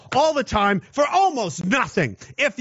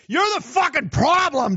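higher, yelled accusation with You're hammered; angry yell; 6 semitones higher than usual. Real, not acted. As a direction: shout You're at them in disgust. Angry rant at listeners.

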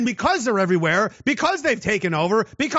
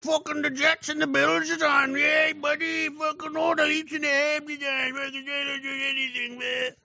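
lightly voiced slack-jawed beer guy, mock-cheerful; sneering impression of a passive couch man; mocking a lazy guy; 4 semitones higher than usual. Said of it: "You just say".

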